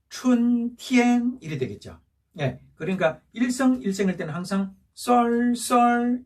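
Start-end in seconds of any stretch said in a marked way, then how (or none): 0.1-1.4 "춘티엔" slow, both syllables on one level pitch; double first tone. Shown as a demonstration. Teacher reading both syllables together, "춘티엔" slow level tones. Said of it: "연이어 읽으면 어떻게 되죠". 4.9-6.2 "썰, 썰" slow, on the same level pitch; double first tone. Shown as a demonstration. Teacher summing up the rule, "썰, 썰" level tones. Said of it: "이게 톤이에요".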